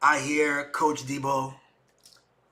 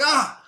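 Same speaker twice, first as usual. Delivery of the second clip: sharp high cry of pain; 11 semitones higher, 6 dB louder than usual. Retold or imitated. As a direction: yelp as the arm is wrenched. Arm pulled higher, pain.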